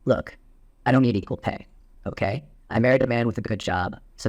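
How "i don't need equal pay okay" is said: ordinary talk, nothing marked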